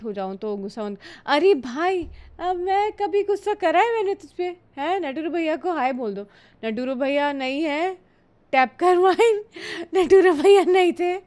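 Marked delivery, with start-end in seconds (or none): 1.3-5.0 mock offended protest. Real, not acted. Viewer says don't get angry. 8.5-11.2 loud laughing protest, voice up high; laughing loudly. Real, not acted. To the chat about the guess, loud laughing.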